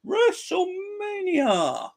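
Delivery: long, high drawn-out ring announcer call; hyped arena announcer drawing out the name. Acted. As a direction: stretch it out like a big-event announcer. Hyping WrestleMania like an announcer.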